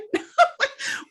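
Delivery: high giggle; a quick high laugh. Real, not acted. Laugh after pardon.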